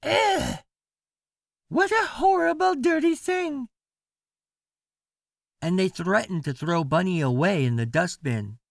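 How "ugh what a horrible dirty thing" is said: much higher, disgusted grown-up; disgusted yell. Acted. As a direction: shriek it like a fussy parent. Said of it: "They yelled".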